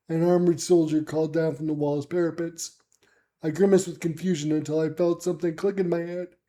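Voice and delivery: ordinary delivery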